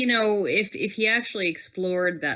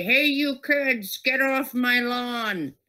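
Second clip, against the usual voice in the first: put-on grumpy old man; 3 semitones higher, slower than usual. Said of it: "the old guy, you know, brandishing his fist".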